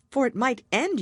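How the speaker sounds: put-on high, worried little girl; a young girl fretting aloud; Alice's voice. Alice talks to herself, worried she may vanish.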